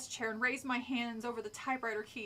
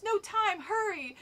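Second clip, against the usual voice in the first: raised, pressing cry; urgent cry; 8 semitones higher, 4 dB louder, slower than usual. A narrator's reading. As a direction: hurry a friend along urgently. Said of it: "I cried to Alex".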